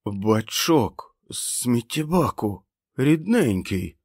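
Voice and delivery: a scared man rasping sweetly; hoarse croak. A narrator's reading. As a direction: croak it out tenderly to calm him. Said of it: "прохрипів він".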